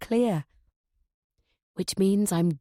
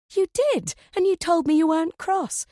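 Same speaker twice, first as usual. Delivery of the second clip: much higher, louder indignant insistence; insistent protest; 9 semitones higher, 4 dB louder than usual. Acted. Amy insisting to Edith, much higher and louder. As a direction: push back hard, voice climbing.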